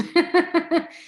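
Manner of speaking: a high, shaky laugh bursting out after the scary news; nervous high laugh. Real, not acted. After sharing she found lumps, a high nervous laugh.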